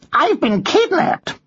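high, slow parrot voice; parrot character. Acted. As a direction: report it in a high-pitched parrot voice. Parrot reports its own kidnapping.

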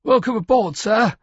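high, loud flustered greeting; startled junior officer's over-pitched salute; blurted out. A narrator's reading. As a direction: blurt it out off balance, voice shooting up. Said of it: "but managed to blurt out".

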